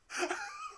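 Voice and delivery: a short burst of laughter; high laugh. Real, not acted. Laughing at the scene.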